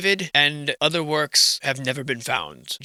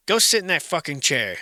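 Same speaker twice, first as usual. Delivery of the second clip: normal talking voice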